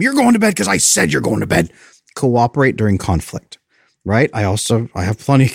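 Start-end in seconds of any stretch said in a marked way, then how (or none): 0.0-1.7 imitating a parent laying down the law, higher; mock angry parent. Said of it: "you don't just scream at them".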